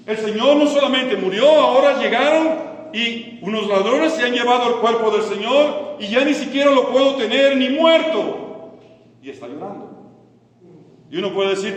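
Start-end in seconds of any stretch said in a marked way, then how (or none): 0.1-8.8 loud, high preacher's cry of outrage; voicing distress with raised, forceful projection; impassioned outcry. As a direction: cry it out at full voice, pitched high. Voices Mary's imagined distress, preaching.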